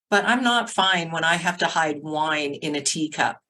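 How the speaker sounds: ordinary delivery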